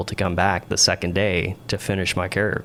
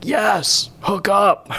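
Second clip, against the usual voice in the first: much higher, louder, impatient bark; irritated shout; 12 semitones higher, 5 dB louder, much slower than usual. Retold or imitated. Air boss snaps at the confused student pilot, high mock shout. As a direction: snap it back like a fed-up boss.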